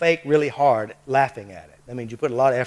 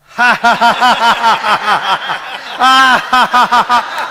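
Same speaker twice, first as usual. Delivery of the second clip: long, high, loud forced laughter; loud fake laugh; 8 semitones higher, 12 dB louder than usual. Shown as a demonstration. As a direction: push a big effortful fake laugh out. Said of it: "Now laugh at it".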